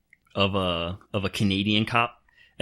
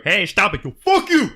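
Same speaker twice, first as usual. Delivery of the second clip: high, loud laughing mock yell; New Yorker retort; 11 semitones higher, 7 dB louder than usual. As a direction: snap back at a cop, laughing. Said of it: "you do that in New York".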